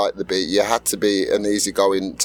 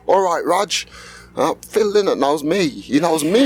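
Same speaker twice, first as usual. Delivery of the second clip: high, excited Irish greeting, imitating the star; 8 semitones higher than usual. Rock star greeting a young fan backstage, in a high Irish voice. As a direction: play the rock star bragging that he knows you, in Irish. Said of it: "it's like [name] going".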